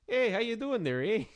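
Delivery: high, bright mock Canadian "eh" voice; exaggerated Canadian accent, high and loud. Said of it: "someone who sounds like that".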